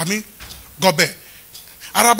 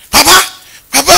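calling Papa; 7 semitones higher, 9 dB louder than usual. Retold or imitated. Students voiced calling pastor.

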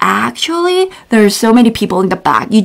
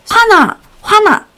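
mock angry; 9 semitones higher, much faster than usual. Shown as a demonstration. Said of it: "teaching casual 화나".

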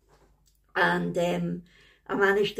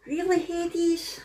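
mock exasperated; 10 semitones higher, slower than usual. Real, not acted. Exasperated at pet interrupting.

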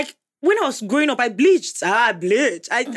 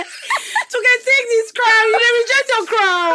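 mock crowning; 12 semitones higher, 5 dB louder than usual. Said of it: "I don't like what you're doing".

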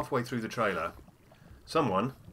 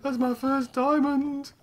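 high, slow, thrilled exclamation; excited; 8 dB louder, slower than usual. Real, not acted. Gamer celebrating his first diamond, high thrilled.

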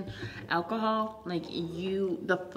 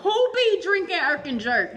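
high, loud playful jab; teasing exclamation; 8 semitones higher, 5 dB louder than usual. Real, not acted. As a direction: tease it loudly.

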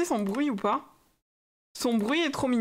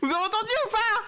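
excited squeal; 10 semitones higher, faster than usual. Real, not acted. Thrilled the creature made noise.

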